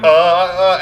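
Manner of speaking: loud, high helpless stammering; flustered poor girl. Acted. Girl being yelled at on phone, high flustered stammer. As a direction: imitate the girl stuck for words. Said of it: "She's just like".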